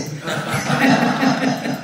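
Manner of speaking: a short, light laugh at the joke; amused laugh. Real, not acted. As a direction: laugh along with the room. Joke about unplanned sharing.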